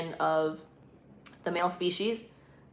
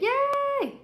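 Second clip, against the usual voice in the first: very high, drawn-out squeal of joy; high celebratory cheer; 3 dB louder than usual. Real, not acted. Streamer caught up on the comments, squealed cheer. Celebrates reaching bottom of chat.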